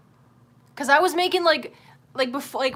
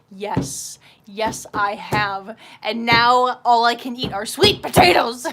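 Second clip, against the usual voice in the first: slow yes building to a loud outburst; fed-up answer that boils over; exasperated reply; 8 dB louder, slower than usual. Acted. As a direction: start deliberate, end yelling in mock despair. Acted exasperated reply to doctor.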